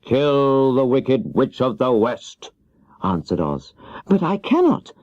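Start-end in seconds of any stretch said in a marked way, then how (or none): 0.0-2.5 slow, heavy wizard command; solemn command. Acted. 4.1-4.9 put-on much higher, startled little-girl cry; Dorothy startled by the wizard's demand; surprised exclamation. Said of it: "exclaimed Dorothy, greatly surprised".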